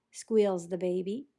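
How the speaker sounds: ordinary delivery, nothing marked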